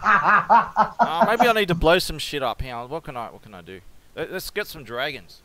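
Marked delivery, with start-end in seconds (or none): 0.1-2.6 laughing. Real, not acted. Laughing over the gravity joke.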